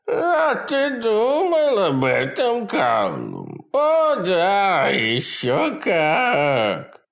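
high, loud, slow gleeful taunt; taunting creature voice. A narrator's reading. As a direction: gloat over his name.

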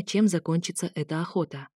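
usual speaking voice

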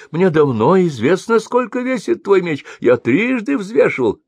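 arguing merchant. A narrator's reading. Merchant disputes the weighing with the mercenary, arguing. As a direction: insist stubbornly, voice up high. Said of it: "заспорил гость".

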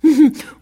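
high, closed little titter; discreet laugh. A narrator's reading. Peacock laughs at the three animals, discreet titter. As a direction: break off for a contained, mocking chuckle. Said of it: "s'interrompit pour rire discrètement".

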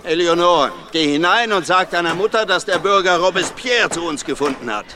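father calling out big news; calling out instructions. Acted. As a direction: send her off with proud urgency. Father calls out to his daughter.

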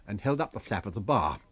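plain reading voice

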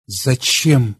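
usual speaking voice

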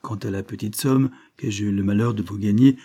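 ordinary delivery

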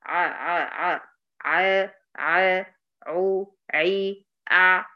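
ع، عو، عي from the throat; correct ع drill. Shown as a demonstration. Teacher drills the right ع for students. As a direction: voice ع deep in the throat. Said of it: "يبقى الصح إيه؟".

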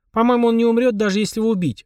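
high, absent-minded musing. A narrator's reading. Полина reassures the boy about the demon, absent-mindedly. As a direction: answer as if thinking of something else. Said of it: "рассеянно ответила Полина".

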